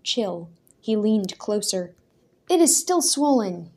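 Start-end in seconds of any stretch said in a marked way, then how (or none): 2.5-3.7 higher, louder accusation; accusing. A narrator's reading. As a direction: catch him out. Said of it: "he accused the man".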